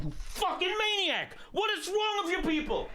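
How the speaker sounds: high, incredulous shout; exasperated yell. Retold or imitated. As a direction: yell it in sheer disbelief. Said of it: "It's like".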